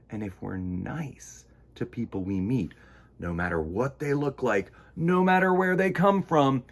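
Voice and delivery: ordinary delivery, nothing marked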